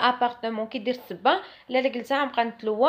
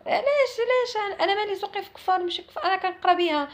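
indignant disbelief; 9 semitones higher than usual. Real, not acted. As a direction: rise high in disbelief. Indignant reply to excuse. (استغراب واستنكار بصوت عالي)

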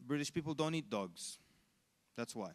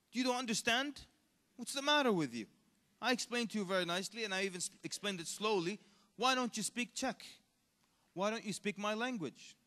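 higher-pitched indignant mock rebuke; imitated exasperated scolding; 5 semitones higher than usual. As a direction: scold like an affronted local losing patience. Acts exasperated Czech lady scolding sheikh.

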